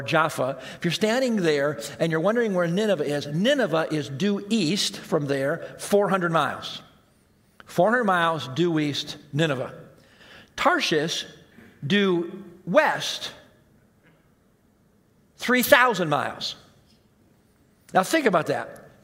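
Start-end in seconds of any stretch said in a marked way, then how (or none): none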